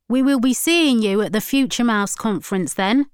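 lightly voiced stern campaigner; lightly voiced Ryan. A narrator's reading. Preacher announcing he will be at the conference, mildly stern. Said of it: "said Ryan".

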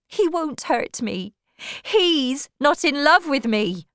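high, slow woman's voice; insistent Tess. Acted. Woman telling suitor the farmer is harmless, insistent.

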